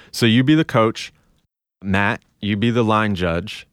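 normal talking voice

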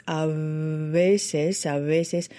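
"A veces" with the v made by both lips buzzing, not teeth; lips-together v. Shown as a demonstration. Coach repeating "a veces" to show the lip sound. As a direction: buzz the lips on "veces", lips never touching teeth. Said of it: "they put the lips together and vibrate".

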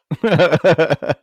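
high burst of laughter; loud laugh. Real, not acted. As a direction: let out a big laugh. Laughing at broken chainsaw.

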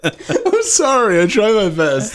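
apologizing through giggles; high, giggly laughing apology. Real, not acted. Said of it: "[name]'s still laughing".

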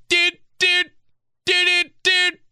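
high, loud sung doots like a car lock chirp; car lock beeps. Retold or imitated. Locking the car, mimicking its chirping beeps. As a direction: chirp each doot like an electronic beep. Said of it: "when you lock your car and it's like".

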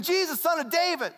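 fast, high, urgent call; acted frantic cry. Shown as a demonstration. Blind man going nuts while people rebuke him, frantic cry. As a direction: blurt it out high and quick like someone going nuts. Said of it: "he's going nuts".

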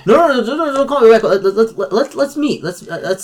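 high, fast, jittery caller; nervous Mickey. Acted. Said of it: "Does he sound nervous?".

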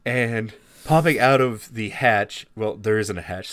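normal talking voice

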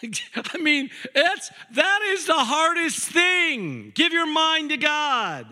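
high, loud and slow; preaching shout. Acted. Preacher crying out the hardest truth, loud and high. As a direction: cry it out at full force.

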